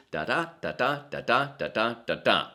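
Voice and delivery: da-DA beats, unstressed then stressed, da da da da da da da da da da; sing-song iambic da da da da da; iambic rhythm. Shown as a demonstration. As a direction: tap out the iambic pulse da DA da DA. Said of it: "sound out in a rhythmic pattern such as".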